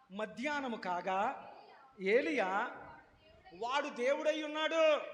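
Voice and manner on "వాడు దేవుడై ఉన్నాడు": high sarcastic taunt; mocking taunt. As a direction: sneer at their god. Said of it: "అంటున్నాడు ఏలియా".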